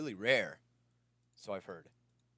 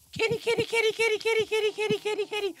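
very high, loud squeaky chant; excited squeal; 16 dB louder than usual. Real, not acted. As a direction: squeal it repeatedly on one high note. Spots a cat he loves.

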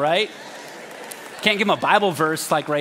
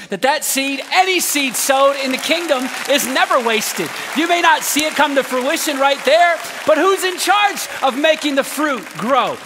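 loud, rising preacher build; full-voiced sermon climax; preaching at full force; 6 semitones higher, 3 dB louder than usual. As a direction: lift the congregation, louder and higher.

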